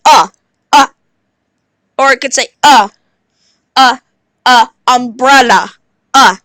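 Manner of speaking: "uh" as a short grunt; short u sound. Shown as a demonstration. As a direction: cut "uh" short.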